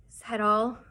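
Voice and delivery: ordinary delivery, nothing marked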